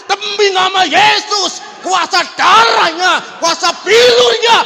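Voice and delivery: huge, high, drawn-out shouts; exorcism yelling. Retold or imitated. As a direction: bellow each phrase slowly at the demon. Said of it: "teriak-teriak gitu".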